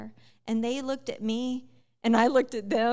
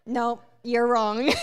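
laughing; 9 dB louder, slower than usual. Retold or imitated. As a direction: laugh through the words, slower. Retelling steward's rejection, laughing.

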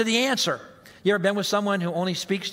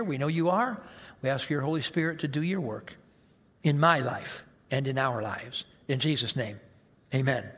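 heartfelt prayer; 3 semitones lower than usual. Real, not acted. Pastor prays for the congregation.

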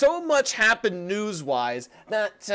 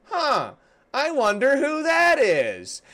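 slow, higher feigned wondering; mock puzzlement; 7 semitones higher, slower than usual. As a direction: play dumb, drawn out and higher.

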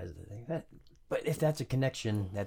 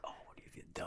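whispered, much softer; hushed; 13 dB quieter, faster than usual. Retold or imitated. Recalling walking up to a bad scene, a hushed whisper. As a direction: breathe it out like a shocked aside.

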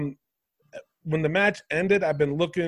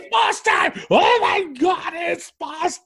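hyped commentator shout; 12 semitones higher, slower than usual. Retold or imitated. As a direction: overact an announcer screaming an entrance. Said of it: "when Sasha came out".

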